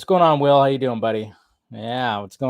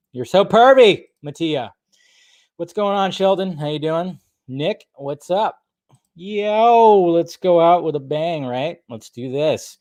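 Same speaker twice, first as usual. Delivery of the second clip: normal talking voice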